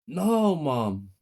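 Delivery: loud, high protest; crying out. A narrator's reading. Brothers crying out to their mother, protesting. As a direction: cry out to mom in dismay. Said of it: "me and my brother cried".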